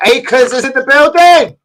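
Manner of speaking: booming excited shout-out; hype announcement. Real, not acted. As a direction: announce him like a ring MC.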